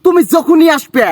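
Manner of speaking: high-pitched Rajshahi accent; Rajshahi dialect. Shown as a demonstration. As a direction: pester a visitor in lively Rajshahi dialect. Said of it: "আপনি আমাদেরকে রাজশাহীর ভাষা শোনান".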